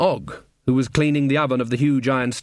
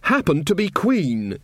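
slow, higher-pitched queenly hauteur; regal; 7 semitones higher, slower than usual. A narrator's reading. New queen pulling rank on a servant, regal. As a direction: draw yourself up and pronounce it royally. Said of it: "drew herself up regally".

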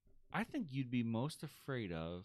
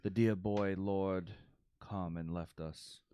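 slow, archaic storyteller voice; olde English speech; 4 semitones lower, slower than usual. Retold or imitated. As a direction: speak like a medieval herald, slowly. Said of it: "talk in old English like".

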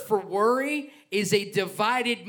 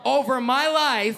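slow, loud, higher declaration; call-and-response lead; 4 semitones higher, 5 dB louder, slower than usual. Real, not acted. Pastor has church say this with him, slow loud lead. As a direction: proclaim it for the congregation to echo. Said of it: "I want you to say this with me".

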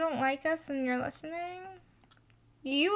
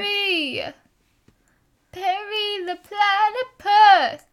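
dramatic cartoon shout; 6 semitones higher, 6 dB louder, slower than usual.

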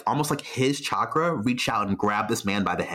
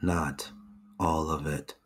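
low, hushed loyal retainer; hushed servant voice; 6 semitones lower, 4 dB quieter than usual. Acted. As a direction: speak softly like an entranced servant. NPC answers quietly.

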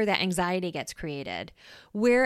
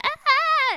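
a sudden high yelp, much louder; mock startled scream; 7 dB louder than usual. Hiker startled by a snake on the trail, high yelp. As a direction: shriek like you just spotted a snake. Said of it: "takes over and screams".

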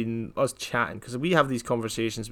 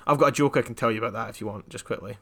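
usual speaking voice

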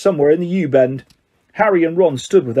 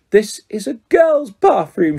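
put-on slow, high sulky ghost girl; moody ghost girl; 5 semitones higher, slower than usual.

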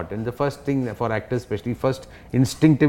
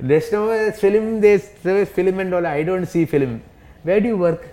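put-on old relatives; 7 semitones higher, 3 dB louder than usual. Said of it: "they both look at me and they say".

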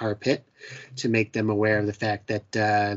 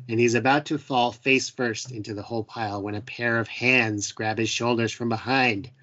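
normal talking voice

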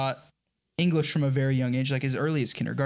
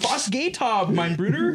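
"Was geht ab, mein Bruder?" in a heavy American accent, pitched up; American-accented German; 6 semitones higher, slower than usual. Shown as a demonstration. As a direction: play it as an American bro speaking German. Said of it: "you say it like you're American".

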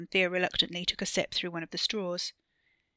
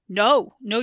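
loud, higher cry of no; alarmed refusal; 4 semitones higher, 11 dB louder than usual. A narrator's reading. Wesley begs Theo not to worship it, loud and alarmed. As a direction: cut them off in horror.

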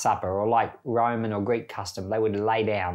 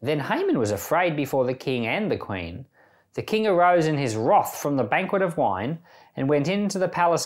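measured Bible reading, a touch higher; scripture reading; 4 semitones higher than usual. Acted. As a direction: read the passage with gravity. Reads Bible passage aloud.

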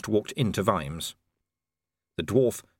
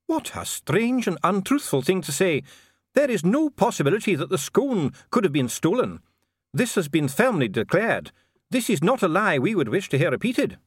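high-pitched, offended dwarf dignitary; indignant dwarf official; 10 semitones higher, 4 dB louder than usual. Acted. Dee denies the theft.

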